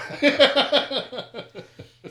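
big high burst of laughter; loud laugh. Real, not acted. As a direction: cackle out loud at the joke. Friends joking, loud burst.

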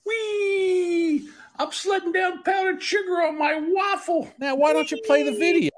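very high squealing character voice; squeaky cartoon voice. TikTok voice clip, high squeal.

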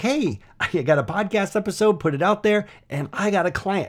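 high-pitched, eager retelling of good news; excited announcement. Retold or imitated. Entrepreneur telling his marketing group about a new client, excited.

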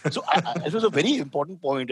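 ordinary delivery

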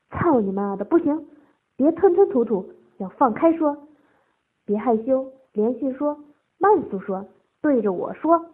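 loud, forceful orders; impatient commands. A narrator's reading. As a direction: bark out the instructions one by one.

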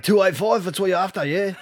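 imitating a seller who can't talk right; mock dodgy bloke. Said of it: "couldn't actually speak properly".

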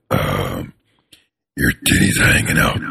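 very low whispery voice; low creepy rasp; creepy deep voice. Shown as a demonstration. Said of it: "We make comments about people".

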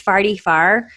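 "farty far", forty-four with ah vowels; Saint Louis accent. Shown as a demonstration. Showing friends the accent, "farty far" with open ah. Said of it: "I have the, the Saint Louis".

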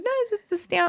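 high sugary customer service tone; forced nice voice. Retold or imitated. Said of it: "I'm like".

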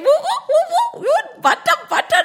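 high, loud laughing woo; gleeful whooping. A narrator's reading. Monkeys whooping while skating on butter. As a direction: whoop with glee.

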